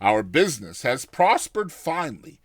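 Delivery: ordinary delivery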